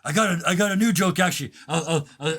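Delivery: high, loud, fast rush; eager actor. Acted. Actor eagerly offering a new joke, fast and high. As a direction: blurt it out keenly.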